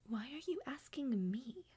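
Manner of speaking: soft unvoiced aside; embarrassed whisper. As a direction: hiss it back, caught out. Dodging an awkward question.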